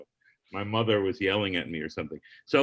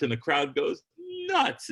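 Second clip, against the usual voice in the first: ordinary delivery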